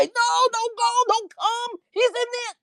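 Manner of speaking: high, urgent pleading warning; panicked plea. Retold or imitated. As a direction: beg him not to come.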